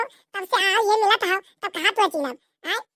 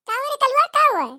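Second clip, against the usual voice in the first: mother calling back; 7 semitones higher, 4 dB louder than usual. Acted. Mother answers Kallu's call.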